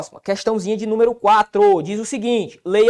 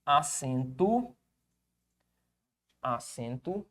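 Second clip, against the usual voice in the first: fading echo; 7 semitones lower, 10 dB quieter, much slower than usual. Shown as a demonstration. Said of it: "uma regra especial que chama acento".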